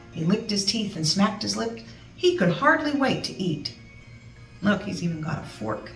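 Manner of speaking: ordinary delivery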